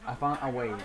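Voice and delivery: usual speaking voice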